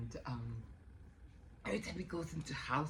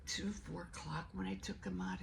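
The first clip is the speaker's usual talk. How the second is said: ordinary talk, nothing marked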